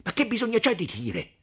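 high, loud impatient retort; angry retort. Acted. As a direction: snap back, exasperated. Renzo's acted angry retort.

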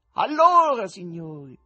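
high, loud opener, then dropping; showman's call. Acted. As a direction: hail the crowd on the first word.

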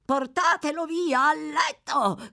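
high, drawn-out crazed cry; delirious shrill-pitched yell. A narrator's reading.

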